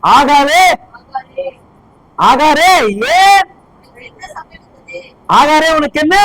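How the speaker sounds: loud, rising call of a loving God; God calling Hagar. Acted. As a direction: call her name out across the desert, lovingly. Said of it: "அன்பை பாருங்களேன்".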